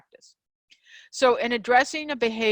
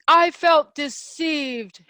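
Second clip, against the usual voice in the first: slow, louder, hurt accusing line; wounded accusation; 6 semitones higher, 5 dB louder, much slower than usual. Shown as a demonstration. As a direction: lay the blame on them with a charged word. Example of a charged accusation.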